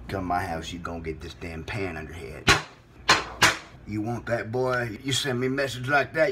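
low menace. Acted. Host threatening caller with pan, low menacing voice.

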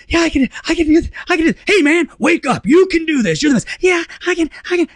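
high-pitched, urgent mock pep talk; frantic self-hype. Arguing himself into giving the talk, high frantic hype. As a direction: psych yourself up in a comic frenzy.

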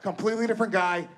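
ordinary talk, nothing marked

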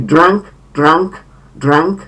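drank with a vowel between a and e; a shading into e. Shown as a demonstration. Teacher models the second form, drank with in-between vowel. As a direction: say drank rising each time, vowel halfway from a to e. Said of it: "przechodzący A w E".

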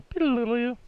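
high mock error jingle; imitated chime. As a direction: sing the little failure tune high. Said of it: "I hear it go".

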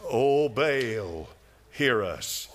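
a hint of a mocking plea; mock pleading. Preacher mimics Baal's prophets again, light mocking plea. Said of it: "Can you hear him?".

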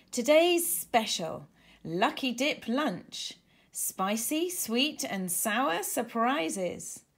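slow, grand announcement; announcing with a flourish. Acted. Danny announcing the special to the restaurant, grandly. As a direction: present it like a showman. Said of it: "with a flourish".

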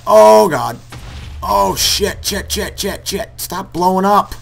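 high, loud, frantic swearing; panicked cursing. Real, not acted. Gamer pleading with creepers to stop blowing up, frantic. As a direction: beg the game to stop, in a panic.

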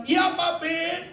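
put-on high, long-drawn child voice; very high, slow child's reply. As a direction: answer Papi in a stretched little-girl call. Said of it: "Kemudian dia bilang".

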